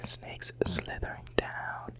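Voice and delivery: ordinary talk, nothing marked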